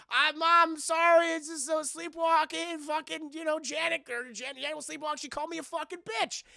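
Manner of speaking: put-on high, flustered girl voice; imitating a panicked teenage girl; frantic daughter. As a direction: explain in a pleading rush to mom. Daughter explains herself to mom.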